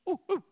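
high-pitched coaxing oohs; high playful ooh noises. Retold or imitated. Dad making high ooh noises to remind daughter of game. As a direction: squeal ooh ooh to catch a toddler's attention. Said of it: "make noises".

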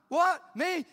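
high, startled "What? Me?"; retold disbelief. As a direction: sound stunned to be chosen. Retelling his stunned reply to God.

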